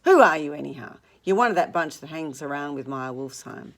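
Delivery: loud, contemptuous demand; bully him with a shout. Acted. Tom attacking Gatsby's background, loud and contemptuous.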